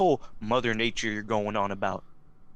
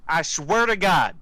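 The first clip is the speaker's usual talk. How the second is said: slow, louder, higher threat; menacing threat; 6 semitones higher, 5 dB louder than usual. Acted. Interrogator threatening to drown Fred, slow menacing threat. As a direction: lean in and scare him.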